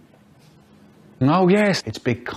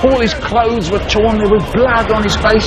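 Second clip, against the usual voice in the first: loud, urgent story narration; narrating a frightening scene loudly; dramatic storytelling; 5 semitones higher, 7 dB louder than usual. A narrator's reading. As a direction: read it like a thriller climax.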